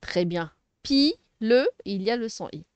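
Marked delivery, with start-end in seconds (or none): 0.8-1.7 "Pi, le" split into two separate beats; syllable by syllable. Shown as a demonstration. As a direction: say "Pi, le" with a clear gap between syllables. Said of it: "Je sépare les syllabes".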